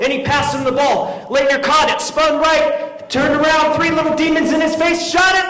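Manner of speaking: high excited commentary rising to a shout; building play-by-play. Retold or imitated. Fan retelling the winning play, mounting excitement. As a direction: climb in pitch and force up to the shot.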